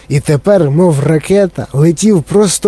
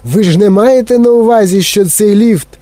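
higher-pitched, incredulous protest; alarmed cry; 6 semitones higher, 3 dB louder than usual. A narrator's reading. Grandpa Joe questions the plan for the lift, alarmed. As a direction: protest high, in mild alarm. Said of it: "крикнув дідунь Джо".

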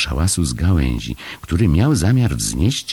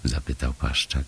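plain reading voice

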